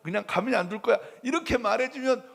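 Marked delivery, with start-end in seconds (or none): 0.0-1.0 raised, quickly building threat; God defending me. Pretend. God threatening the tormentor, rapid stern threat. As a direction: fire it off fast, protective and fierce. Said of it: "밤중에 나타나서".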